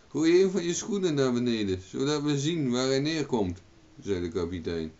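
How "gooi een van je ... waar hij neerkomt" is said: raised, higher instruction; calling up. A narrator's reading. Captain tells Owen to drop a shoe, calling up. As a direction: call the order up to him.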